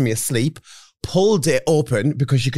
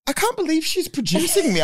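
high incredulous quote; 14 semitones higher than usual. Retold or imitated. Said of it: "And I was like".

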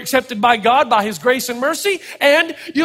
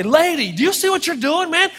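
cranky telling-off; 5 semitones higher than usual. Retold or imitated. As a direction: tell her off, tired and fed up. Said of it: "I just wanted to walk up and say".

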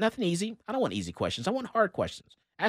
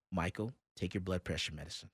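low, much softer retold hush; hushed warning; 7 semitones lower, 14 dB quieter than usual. Coworker quietly warns the yelling boss, hushed. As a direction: quietly tell a friend to calm down. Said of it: "walked over to me and whispered".

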